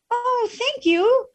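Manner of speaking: high, slow sarcastic thanks; mock gratitude. Upset person thanks whoever said calm down, sarcastically. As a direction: gush with obviously fake gratitude. Mocking a reply nobody ever gives.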